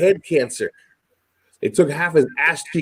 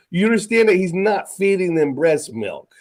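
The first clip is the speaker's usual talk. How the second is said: ordinary talk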